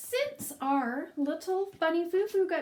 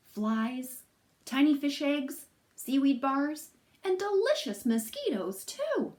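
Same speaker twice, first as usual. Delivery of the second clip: ordinary delivery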